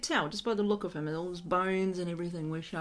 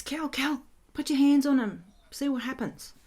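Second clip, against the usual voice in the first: lightly voiced wife, pitched up; urgent wife; 6 semitones higher, 4 dB louder than usual. Wife asking Cal to lay hands on him, lightly urgent. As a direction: call out higher, as the wife. Wife urging the speaker to help.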